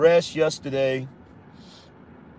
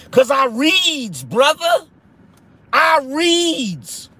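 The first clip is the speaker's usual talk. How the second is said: mock proud reader; 8 semitones higher, slower than usual. Answers mockers in put-on voice.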